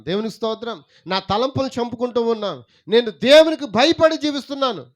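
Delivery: loud, high-pitched sermon delivery; full-force preaching. Acted. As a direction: proclaim it to the whole congregation. (గొంతెత్తి ఉద్వేగంగా ప్రసంగిస్తూ)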